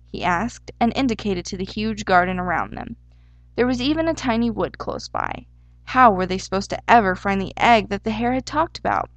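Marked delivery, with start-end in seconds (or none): none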